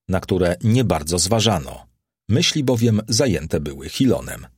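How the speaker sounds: usual speaking voice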